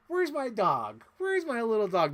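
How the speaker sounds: high, loud anxious calling for a lost dog; mock-panicked search for a pet dog; calling for her dog. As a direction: call out worried for the little dog. Frantic owner calling for dog.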